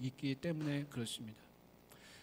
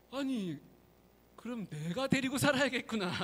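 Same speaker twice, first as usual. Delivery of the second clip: much higher, teasing spirit voice; sly mountain god; 7 semitones higher than usual. Acted. Mountain god deciding to keep Nolbu's wife, high and sly. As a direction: tease him with a pleased lilt.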